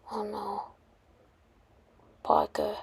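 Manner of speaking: very soft, stunned mourning; hushed grief. Acted. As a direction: say it faintly over the fallen hero. Iron Man mourns Peter's death.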